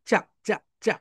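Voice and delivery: চা said as a crisp cha three times; cha sound drill. Shown as a demonstration. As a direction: repeat চা, চা, চা with a clear ch sound. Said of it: "কি sound দিবে বলতো". (চা শব্দটা তিনবার স্পষ্ট চ দিয়ে)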